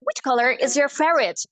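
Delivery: slow American accent, R in "color". Shown as a demonstration. Teacher models color question, American accent. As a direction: sound every R, American. American take before British conversion.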